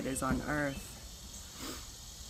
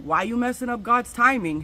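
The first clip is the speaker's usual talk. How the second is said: higher, louder scolding inner voice; inner voice nagging; 6 semitones higher, 8 dB louder than usual. Retold or imitated. Gut voice scolding her, high and loud. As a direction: let her gut demand an answer. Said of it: "And they're like".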